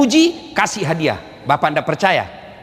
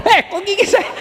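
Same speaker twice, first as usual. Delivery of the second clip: mock pained mother; 13 semitones higher than usual. Mother bitten while breastfeeding.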